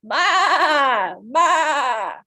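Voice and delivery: goat bleat. Shown as a demonstration. Teacher bleating like a goat for the class. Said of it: "sounds maybe like a goat, like".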